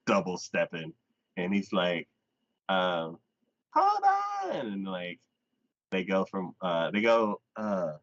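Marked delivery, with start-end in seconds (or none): 3.7-4.7 very high mock call; imitated call to wait. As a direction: call after someone hurrying off, hammed-up. Voices him calling after her.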